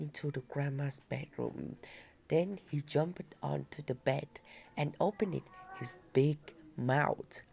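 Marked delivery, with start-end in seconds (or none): none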